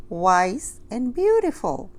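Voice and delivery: vain sandwich. A narrator's reading. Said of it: "the sandwich".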